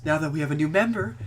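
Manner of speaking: usual speaking voice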